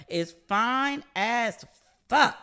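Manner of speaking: swooning, dragging the words out slowly; drawn-out gushing. Real, not acted. To listeners, gushing that Idris Elba is fine, slow drawn-out swoon. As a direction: stretch out is fine as, then land fuck.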